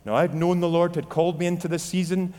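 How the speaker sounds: ordinary talk, nothing marked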